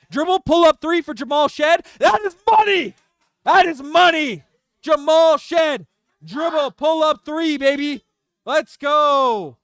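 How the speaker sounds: high, loud celebration of a big shot; ecstatic shouting. Real, not acted. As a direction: holler it like a hyped-up fan commentator. Celebrating a big three-pointer.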